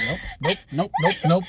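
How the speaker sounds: repeated nopes, laughing; mock refusal. Man refusing, laughing mock nopes. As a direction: shake it off with a laugh. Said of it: "I'm like".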